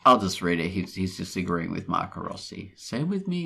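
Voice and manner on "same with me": higher-pitched quoted reply; quoted agreement. Acted. Host reading out a brief agreement, higher-pitched. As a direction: say it higher, at normal pace. Reads a quote out twice, differently.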